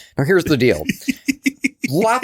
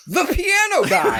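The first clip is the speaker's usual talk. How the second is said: pitched way up and drawn out, a dead-serious line; a hammed-up deadpan quote. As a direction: stare him down and say it slowly, high. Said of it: "looks me dead in the eyes and says".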